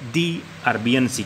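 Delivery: slow "दी Arabian सी" with a long "thee"; the before vowel. Shown as a demonstration. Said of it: "द को क्या बोलेंगे आप".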